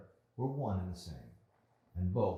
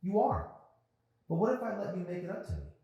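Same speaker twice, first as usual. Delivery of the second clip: suddenly much higher, quick and playful; voice lifting; 13 semitones higher, 6 dB louder than usual. Acted. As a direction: brighten up on the offer. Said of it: "Her voice lifted suddenly".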